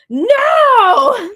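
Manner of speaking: hammed-up high, loud shout of protest; imitating upset. Angela denies it to Quinn, mock upset shout. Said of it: "she starts to get upset here".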